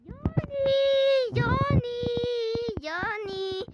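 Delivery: high, mock-sobbing call, slow at the end; pretend crying. Lost toy butterfly cries for Johnny, mock sobbing. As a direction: whimper the name like a lost child. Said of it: "Ahora está llorando".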